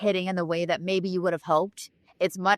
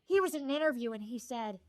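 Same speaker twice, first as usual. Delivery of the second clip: high, airy diva voice throughout; higher-pitched mock Coolidge telling the story; Jennifer Coolidge voice; 6 semitones higher than usual. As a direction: tell it as Jennifer Coolidge would, dreamily. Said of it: "I think you should do it in her voice".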